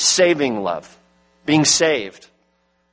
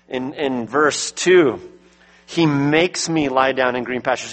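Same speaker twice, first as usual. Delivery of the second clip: usual speaking voice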